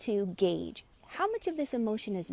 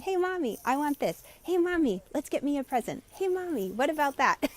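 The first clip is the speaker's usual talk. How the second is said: put-on high, pleading little-girl voice, much higher; imitating a toddler girl; 6 semitones higher than usual. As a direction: play the nagging toddler tugging at mom. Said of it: "toddler girl saying".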